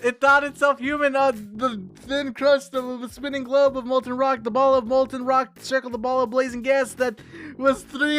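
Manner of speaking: awed storyteller voice; poem reading. A narrator's reading. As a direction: tell it like a cosmic fairy tale. Reads poem aloud dramatically.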